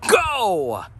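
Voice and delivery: high, drawn-out hype cry; hyped shout. Real, not acted. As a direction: kick off the show with a big rallying yell. Channel catchphrase opener, hyped shout.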